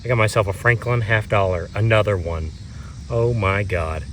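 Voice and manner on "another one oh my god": usual speaking voice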